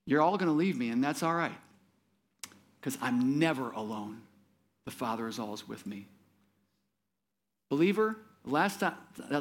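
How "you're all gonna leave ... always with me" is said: quiet reassurance, sinking lower and softer; calm acceptance, no self-pity. Retold or imitated. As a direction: accept it gently, without complaint. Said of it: "Jesus is not having a pity party".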